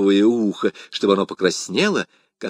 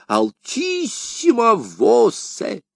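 high, slow intoned Latin; a priest intoning the Latin, high and slow; loudest voice; 11 semitones higher, much slower than usual. A narrator's reading. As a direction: ring it out like chant. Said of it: "самым громким голосом".

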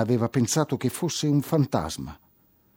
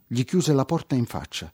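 plain reading voice

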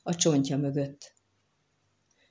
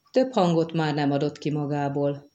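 plain reading voice

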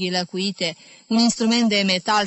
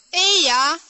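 loud, much higher cheer; shouted greeting; 9 semitones higher, 5 dB louder than usual. A narrator's reading. Jim greets Medi at the door with a loud shout. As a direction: call it out with a salute. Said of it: "strigă Jim".

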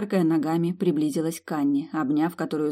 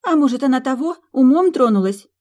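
worried; 8 semitones higher, 8 dB louder than usual. Acted. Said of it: "обеспокоенно предположила Сьюзи".

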